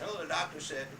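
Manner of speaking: normal talking voice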